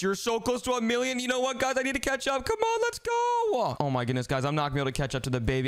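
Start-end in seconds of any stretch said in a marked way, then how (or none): 2.4-3.8 excited cheer. Real, not acted. Hyping himself up to catch up.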